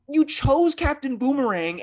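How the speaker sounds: ordinary delivery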